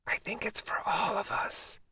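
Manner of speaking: soft unvoiced whisper; hushed whisper in a dark cinema. A narrator's reading. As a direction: whisper it so the theater can't hear. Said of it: "I whispered back".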